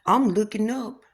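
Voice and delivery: ordinary talk, nothing marked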